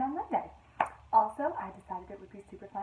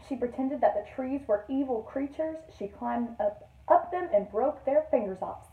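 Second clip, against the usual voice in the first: put-on expressive storytime reading to children; lively picture-book narrator; read-aloud narration; 6 dB louder than usual. A narrator's reading. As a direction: read it like a teacher to her class. Teacher reads picture book aloud.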